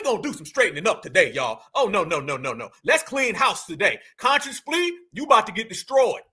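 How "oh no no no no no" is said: normal talking voice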